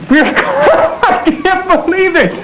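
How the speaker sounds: high, drawn out through laughter; elated laughing. Acted. Expectant father bursting with joy, elated and laughing. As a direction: laugh the words out in high disbelief.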